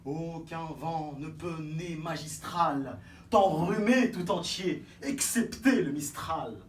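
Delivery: slow, grand, emphatic. Acted. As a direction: stretch every word with pomp.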